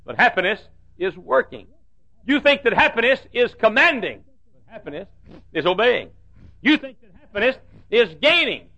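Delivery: rhythmic rising-and-falling sermon cadence; preacher contrasting happiness for the congregation; preaching. Acted. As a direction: set each pair up and knock it down. Preaching at full force.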